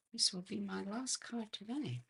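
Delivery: normal talking voice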